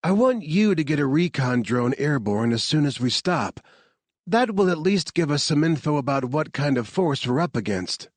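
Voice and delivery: a hint of a brisk commander giving orders; crisp leader's instructions, lightly voiced; lightly voiced team leader. A narrator's reading. Team leader briefing Harvard before the stop, lightly voiced and brisk.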